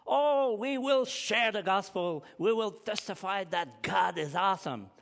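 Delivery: high, loud, hammed-up eager promise; mock enthusiasm. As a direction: parody their empty zeal. Mocking boastful Christians.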